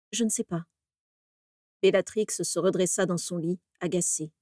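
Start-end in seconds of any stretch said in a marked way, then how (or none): 0.1-0.7 softer, drowsy I don't know; quiet and sleepy. A narrator's reading. Bellatrix, just woken, answers her sister softly. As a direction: mumble it, still half asleep. Bellatrix half-asleep at night.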